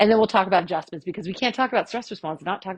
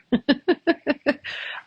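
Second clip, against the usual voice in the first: playful fake snore; snoring noise, then laughing; mock snore; 8 semitones higher, 3 dB louder than usual. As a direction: snore as a joke. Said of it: "You like my snore?".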